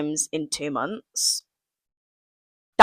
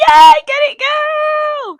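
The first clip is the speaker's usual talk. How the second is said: high, loud squealed cheer; mock celebration; 6 dB louder, slower than usual. Vlogger hyping herself up over unemployment, squealed mock cheer.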